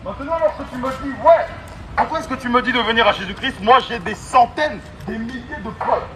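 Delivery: normal talking voice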